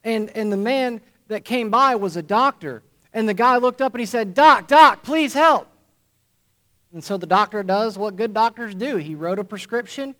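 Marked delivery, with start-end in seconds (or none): 4.3-5.7 loud, high cry up from a pit; desperate shout from below; calling for help. Acted. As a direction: yell up to the passer-by for help. Man in hole calling up.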